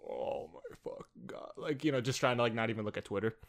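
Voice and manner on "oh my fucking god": slow, hushed groan of dismay; mock despair. Co-host imagining the first day of being canceled, hushed mock despair. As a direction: groan it under your breath, drawn out.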